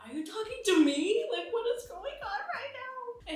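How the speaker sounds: high-pitched frantic questioning, loud at first; panicked disbelief. Retold or imitated. Injured niece to the man carrying her, panicked and shrill. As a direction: squeal it out in bewildered alarm.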